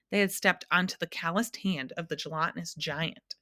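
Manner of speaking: ordinary delivery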